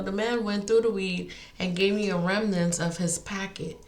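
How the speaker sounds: usual speaking voice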